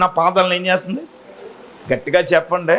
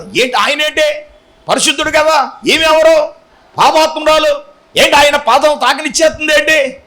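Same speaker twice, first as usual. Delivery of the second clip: higher, mocking Pharisee muttering to himself; Simon's scornful thought; 6 semitones higher than usual. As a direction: sneer at the sinful woman, pitched up. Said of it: "మనసులో అనుకుంటున్నాడు". (పరిసయ్యుని ఎగతాళి స్వరం, కాస్త ఎత్తుగా)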